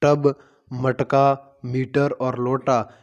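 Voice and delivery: each word drawn out slowly for a class; slow, clear teacher read-out of a word list. Shown as a demonstration. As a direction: read the words slowly so children can follow. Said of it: "इनमें यहाँ पर ट है".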